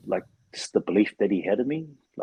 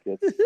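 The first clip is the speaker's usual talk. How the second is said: laugh; 3 dB louder than usual. Real, not acted.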